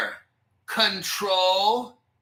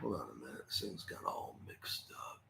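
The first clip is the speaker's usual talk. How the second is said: whispered to himself, much softer; muttered whisper; 22 dB quieter than usual. Real, not acted. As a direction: mutter under your breath at the screen.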